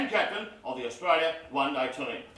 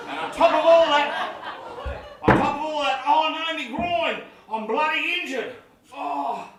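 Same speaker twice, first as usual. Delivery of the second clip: high, loud mock agony; hammed-up injury; 6 semitones higher, 7 dB louder than usual. Captain faking a groin injury, hammed-up loud cry. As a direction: overplay the pain like a diver.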